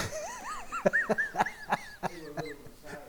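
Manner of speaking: long, high-pitched laugh. Real, not acted. Teacher amused after his example about Dave, high drawn-out laugh.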